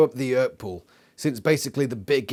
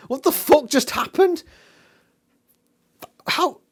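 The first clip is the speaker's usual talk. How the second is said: incredulous questions climbing much higher and louder; baffled outburst; 12 semitones higher, 7 dB louder than usual. Real, not acted. Reviewer ranting at the plot twist, much higher, baffled. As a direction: pile on the disbelief, voice cracking upward.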